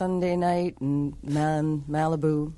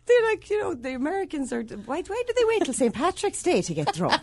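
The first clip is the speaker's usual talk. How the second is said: mock Irish local; 11 semitones higher than usual. Imitating the Irish view.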